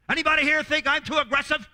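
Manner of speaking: loud, high, brash question to the staff; loud challenge. Retold or imitated. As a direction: bark it at the team like a boss.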